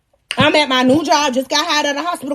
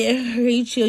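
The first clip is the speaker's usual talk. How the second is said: ordinary talk, nothing marked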